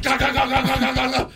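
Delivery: high, rapid-fire pounding noise; racing heartbeat imitation. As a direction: mimic a heart hammering in panic. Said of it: "My heart is like".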